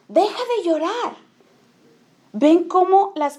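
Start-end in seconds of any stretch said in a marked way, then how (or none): 0.1-1.2 más aguda, una madre que corta el llanto; madre impaciente imitada. Retold or imitated. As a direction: imita a una mamá harta que manda callar. (la mamá que le ordena a la niña dejar de llorar, aguda e impaciente)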